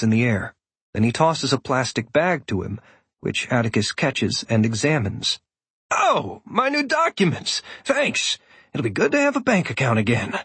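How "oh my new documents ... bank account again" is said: delighted. A narrator's reading. Atticus delighted with new documents.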